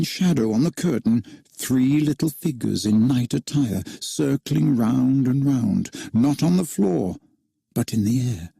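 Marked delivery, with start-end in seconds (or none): none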